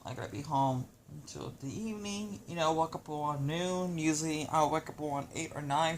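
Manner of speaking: ordinary talk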